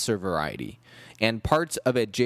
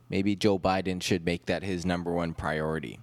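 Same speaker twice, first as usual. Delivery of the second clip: usual speaking voice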